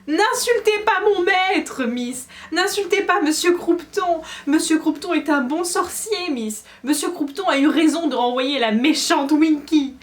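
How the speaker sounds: high, loud, slow shrieking elf; shrill elf shriek. A narrator's reading. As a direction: shriek in defence of her master. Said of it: "poussa un hurlement suraigu".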